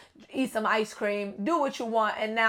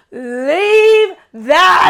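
put-on loud, high southern accent, near a crying shout; a southern woman hollering at her friend; 8 semitones higher, 15 dB louder than usual. Girl talking to a listener in a loud southern accent. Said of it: "in this southern accent".

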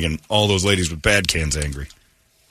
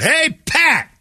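high-pitched heckler's holler; retold fan yell, pitch leaping; screamed out; 3 dB louder than usual. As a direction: scream it up to the booth. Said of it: "And I screamed out".

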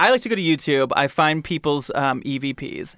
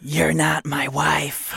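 slow, drawn-out ghostly line; unearthly voice; much slower than usual. Pretend. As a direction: stretch it out like a ghost.

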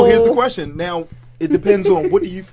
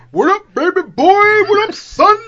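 higher, louder mock bro voice; 12 semitones higher, 7 dB louder than usual.

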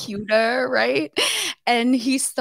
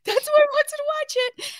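very high, quicker laughing squeal; faster hysterical repeat; 3 dB louder, faster than usual. Real, not acted. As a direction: rattle it off again, still cracking up. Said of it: "now I'm hysterical laughing".

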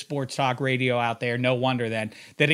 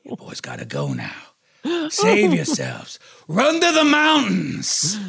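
milk the final farewell; overacted death scene; 12 semitones higher than usual. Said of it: "I'm gonna be Harry Dean".

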